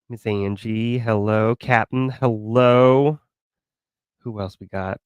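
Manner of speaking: ordinary talk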